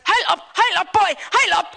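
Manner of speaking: high, loud panicky yell; yelling at the camel. Retold or imitated. Louise yells at her wandering camel, loud and high. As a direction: shout it at a runaway camel. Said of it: "all I can hear is".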